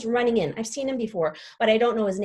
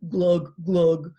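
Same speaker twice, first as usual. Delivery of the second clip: fish gurgling; 4 semitones lower, 5 dB louder than usual. Acted. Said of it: "are all like".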